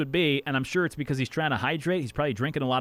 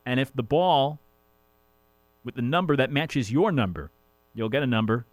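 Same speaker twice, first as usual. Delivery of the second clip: ordinary delivery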